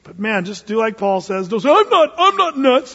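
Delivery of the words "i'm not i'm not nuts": high, loud, slow whiny protest; defensive protest. Shown as a demonstration. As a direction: protest too much, shrill with offense. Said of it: "Don't just say".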